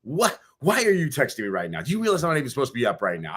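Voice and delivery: high, quick indignant questioning; mock-outraged rapid demand; so mad. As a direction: retell it as an exasperated scolding. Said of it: "I was so mad".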